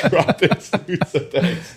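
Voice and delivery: high, slow words broken by laughter; giggling thanks while still out of breath; laughing. Real, not acted. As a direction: laugh your way through the thanks. Laughing while taking off shirt.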